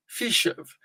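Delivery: "fish of" run together, "of" weak as "v"; weak form of. Shown as a demonstration. Teacher models the weak "of" for students. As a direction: let "of" shrink to a "v" on "fish". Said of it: "ele é a forma fraca".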